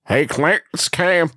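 lightly voiced folksy caller trying out a character; a hint of a local ham seller; Cameron Ham. Rehearsing the prank call greeting, lightly put-on character voice. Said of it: "do the voice first".

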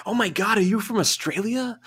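very high-pitched mock-amazed stranger; excited stranger. Stranger in Vegas hearing an Australian talk, mock excited. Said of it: "strangers coming up to me".

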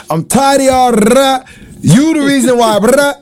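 teacher snapping. Retold or imitated. High school teacher snapping at his students, loud scolding. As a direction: go off on a late, chatty class. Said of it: "I used to snap".

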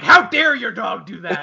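high, loud indignation; mock outrage. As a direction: scold in hammed-up offence. Mock outrage at a friend's dog.

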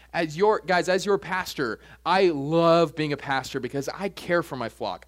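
ordinary talk, nothing marked

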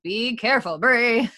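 mock warning. Podcast host warning Bree as she retells, mock cautioning. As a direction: caution her with rising volume and pitch.

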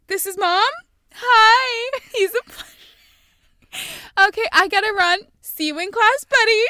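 loud, high-pitched parody teacher; chirpy teacher imitation. Teacher meeting a student in public, hammed-up greeting. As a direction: overdo a teacher's public greeting, breaking into laughs. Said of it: "people pretending they're teachers".

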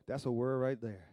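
normal talking voice

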